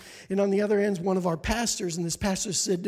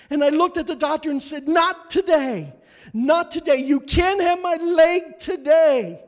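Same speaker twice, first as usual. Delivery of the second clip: raised, pleading voice of a frantic mom, higher and louder; desperate mother; 7 semitones higher, 6 dB louder than usual. Retold or imitated. Mother refusing the doctor for her children's sake, desperate.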